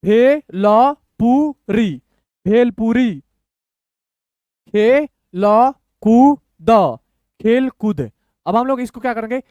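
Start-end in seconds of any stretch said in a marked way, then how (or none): 0.0-1.9 bhelpuri very slow, in separate syllables. Shown as a demonstration. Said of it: "तोड़ तोड़ के पढ़िए". 4.7-8.1 very slow, long gaps inside the word; syllable by syllable. Shown as a demonstration. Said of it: "तोड़ तोड़ के पढ़िए".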